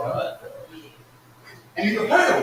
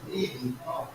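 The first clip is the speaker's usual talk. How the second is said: quoting scripture softly; 5 semitones lower, 8 dB quieter than usual. Retold or imitated. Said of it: "he said in his word".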